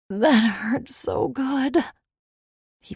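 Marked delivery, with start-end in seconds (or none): 0.1-1.8 slow, blissful whisper. A narrator's reading. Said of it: "I whispered into the fur".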